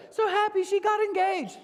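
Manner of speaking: high, sing-song fake delight; fake gushing. Mocking a friend's post with fake gushing joy. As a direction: gush with obvious fake cheer.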